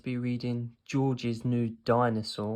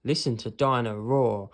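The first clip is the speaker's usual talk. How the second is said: ordinary delivery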